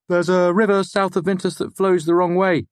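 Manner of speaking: student reciting a fact to the lecturer. Acted. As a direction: offer the fact hopefully. Voices student Fenton.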